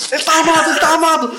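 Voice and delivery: high, loud panicked yell; alarmed shout. Retold or imitated. Acted police officer shouting.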